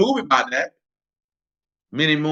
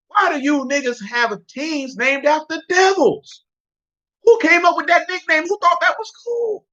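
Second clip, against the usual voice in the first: very high-pitched disbelief; high, exasperated questioning; incredulous rant; 12 semitones higher than usual. Real, not acted. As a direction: demand answers, voice pitched way up. Incredulous rant about devil team names.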